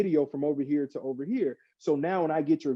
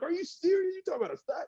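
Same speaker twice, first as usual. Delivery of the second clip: high, incredulous, trailing off softer; pitched-up, annoyed disbelief; exasperated disbelief; 4 dB quieter, faster than usual. Retold or imitated. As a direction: play it as mock outrage at a silly claim. Mock-exasperated retort to niece, nephews.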